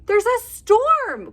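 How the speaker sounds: high, slow, urgent hare cry. Acted. Hare warning the friends about the storm, high alarmed cry. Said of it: "cries Hare".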